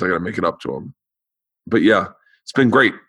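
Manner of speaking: ordinary talk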